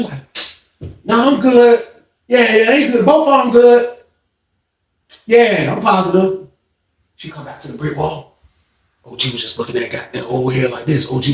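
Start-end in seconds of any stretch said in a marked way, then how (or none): 1.0-6.5 high reassuring voice; lady's voice. Retold or imitated. Lady reassuring her boss on the intercom, high voice. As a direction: brush off the concern.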